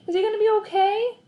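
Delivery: put-on high, anxious little boy asking; a boy fretting over his hurt dog; worried kid voice. A narrator's reading. Boy asks the vet about his dog, high and worried.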